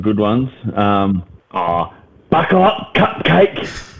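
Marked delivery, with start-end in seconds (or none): 2.3-3.9 high, teasing mum voice; imitating mum. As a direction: tease like a mum about to speed off. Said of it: "mum's got a couple".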